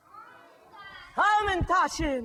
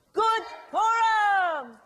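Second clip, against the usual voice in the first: higher, louder, slow joyful shout; bellowed holiday greeting; loud lively greeting; 7 semitones higher, 6 dB louder, much slower than usual. Shown as a demonstration. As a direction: ring it out full of life. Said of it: "he sounds loud and alive".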